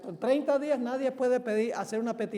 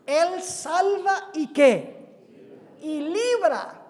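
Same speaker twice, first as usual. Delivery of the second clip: loud, slow proclamation with stretched key words; preaching at full force; 4 semitones higher, 7 dB louder, slower than usual. Acted. Preacher proclaims God's rescue to the congregation, preaching at full force. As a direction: proclaim the verse boldly, landing hard on each phrase.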